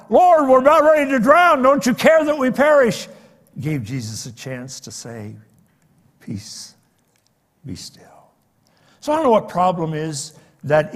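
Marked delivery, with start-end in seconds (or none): 0.1-3.1 high, loud cry of panic; frightened cry. Retold or imitated. Disciples fear drowning in storm. 6.2-8.3 soft, hushed whisper; calm the storm in a whisper; whispered command. Retold or imitated. Jesus calms the storm.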